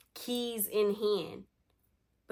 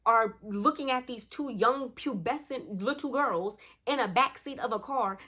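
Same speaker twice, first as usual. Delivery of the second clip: higher-pitched, indignant mock tirade; snapping, retold; 5 semitones higher than usual. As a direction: act out a mother tearing into her. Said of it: "Tasha snapped on her".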